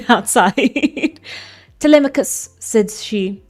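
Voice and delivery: steady storybook reading voice; story narration. A narrator's reading. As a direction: read it like an old epic tale. Reads the Odyssey aloud.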